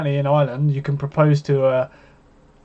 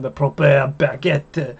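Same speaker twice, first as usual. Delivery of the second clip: slow, hammed-up French accent on "The proper baguette"; drawn-out mock French accent, slow; posh French accent; slower than usual. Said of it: "and then you could be like".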